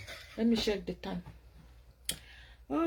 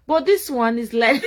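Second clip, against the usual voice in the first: bursting into loud laughter; laughing; 6 semitones higher, 9 dB louder than usual. Real, not acted. Laughing to viewers about her dim tired eye.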